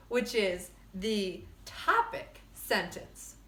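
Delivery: ordinary delivery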